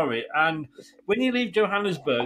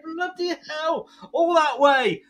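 amazed; 11 semitones higher, slower than usual. Retold or imitated. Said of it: "And I'm like".

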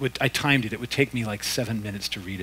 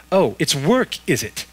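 higher, louder sarcastic snap; snapped; 4 semitones higher, 7 dB louder than usual. A narrator's reading. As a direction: snap it back at him. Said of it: "snapped the ghost".

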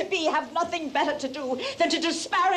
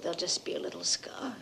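hushed whisper; 7 semitones lower, 8 dB quieter, faster than usual. Acted. Mother tending son's wound.

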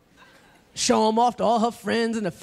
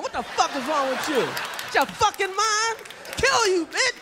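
mock rage; 6 semitones higher than usual. Acted shaking a woman.

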